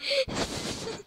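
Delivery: airy high laugh; breathy giggle; breathless laugh. Real, not acted. Laughing at the creation.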